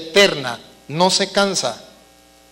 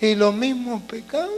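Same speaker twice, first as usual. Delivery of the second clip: embarrassed penitent; 4 semitones higher than usual. Acted. Reluctant sinner balking at confessing the same sins, acted embarrassment. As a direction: voice someone too ashamed to confess. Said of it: "me da pena".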